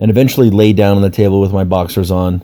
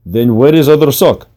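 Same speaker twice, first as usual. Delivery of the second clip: Russian masseuse, pitched higher; Russian accent; 5 semitones higher, 4 dB louder than usual. A narrator's reading. Masseuse pressing client about the missing sock, Russian accent. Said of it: "said in her Russian accent".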